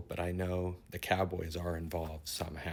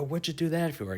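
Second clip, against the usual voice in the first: much higher, alarmed question to the bear; startled protest; 9 semitones higher, 4 dB louder than usual. Retold or imitated. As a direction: snap it out in disbelief. Dreamer scolding the bear.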